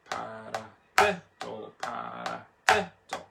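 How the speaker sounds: paradiddle with DI punched; slow chant, hitting the third syllable "di"; accent on third syllable. Shown as a demonstration. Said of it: "two, and three".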